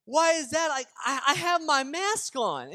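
a mock-indignant customer; indignant protest. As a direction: plead that you did everything right. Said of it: "I'm like".

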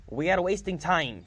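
peeved. Acted. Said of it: "she said in a peeved voice".

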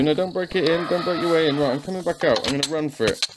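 ordinary delivery

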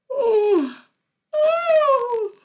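big, loud, high mock yawn; acted yawn. Dinosaur sprinkled with fairy dust, exaggerated yawn. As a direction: yawn hugely and high for show.

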